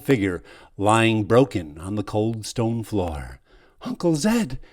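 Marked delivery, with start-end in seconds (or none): 3.8-4.6 calling out. Acted. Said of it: "you cry".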